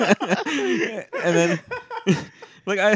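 real laughter breaking through; cracking up mid-story; laughing. Real, not acted. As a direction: laugh hard as you tell it. Laughing while telling story.

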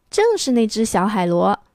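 usual speaking voice

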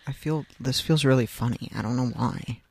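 echoey mic sound. Real, not acted. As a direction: voice with a roomy echo. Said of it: "I sound, like, echoey".

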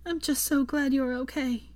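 lightly voiced teary relief; tearful smile. Hermione to recovering Draco, relieved through tears. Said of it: "smiled through her tears".

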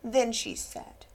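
plain reading voice

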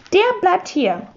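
"Der bleibt hier" with "Der" pitched high; emphatic. A narrator's reading. Herr Mohn refuses to lend the fish, emphatic "Der bleibt hier". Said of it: "sagte Herr Mohn mit Nachdruck".

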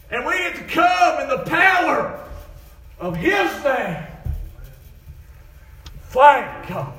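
high, building revival preacher's cry; full-force preaching. Real, not acted. Preacher rallying the church round the rock, full-force preaching. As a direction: preach it out loud, drawing out the big words.

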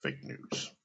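"fake news" said softly and darkly; quiet, ominous. Shown as a demonstration. Naming Trump's two deadliest words, said soft. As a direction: let "fake news" drop soft and grave. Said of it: "the two deadliest words".

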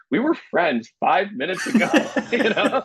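laughing through the words. Real, not acted. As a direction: chuckle out the punchline. Laughing at the absurdity.

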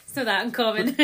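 laughing. Real, not acted. Joke about red-haired Scots.

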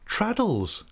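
a high, triumphant reveal of the name. A narrator's reading. Narrator answering Steerforth's guess, triumphant and high. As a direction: announce it like a winning card. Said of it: "I replied triumphantly".

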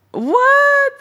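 high, loud disbelieving what; stunned shout of disbelief; shocked. Real, not acted. Shocked at the answer.

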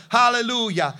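ordinary delivery

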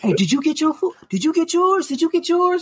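mock the self-appointed mayor of the place; nosy busybody. Mimicking nosy customer at Popeyes.

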